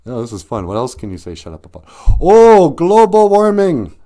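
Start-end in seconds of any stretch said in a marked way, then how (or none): 2.2-4.0 loud high oh global warming; hammed-up loud exclamation; mock outburst. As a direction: fake shock at the topic. Mock outrage topic.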